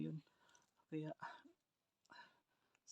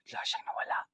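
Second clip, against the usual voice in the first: whispering while hiding; 4 dB louder than usual. Real, not acted. He vanished, tense hiding.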